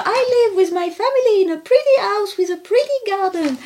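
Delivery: put-on high, sing-song little boy reading; child voice. Reading the book aloud to parents in a high child voice. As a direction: read it like an eager eight-year-old. Reading Charlie's story line.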